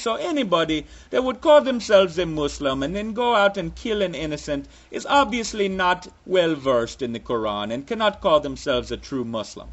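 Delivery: put-on part Russian, part Jamaican, part Transylvanian vampire accent.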